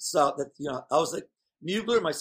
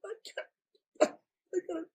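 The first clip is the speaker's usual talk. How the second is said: imitating his own panic, voice shooting up high and thin; panicked whimper; 13 semitones higher, 3 dB quieter, much faster than usual. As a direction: whine it like you're about to fall apart.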